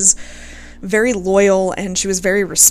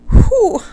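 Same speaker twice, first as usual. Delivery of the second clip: shaky exhale; 13 semitones higher, 7 dB louder than usual. Real, not acted. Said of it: "I was not expecting to get this emotional".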